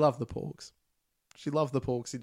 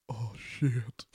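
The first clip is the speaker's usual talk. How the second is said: whispered. Retold or imitated. Imitating someone in screening.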